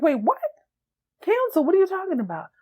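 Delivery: high-pitched incredulous mock outburst; disbelief. Woman questioning boyfriend about cancellation, disbelief. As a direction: voice jumps up, imitating her disbelief. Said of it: "She can't believe it".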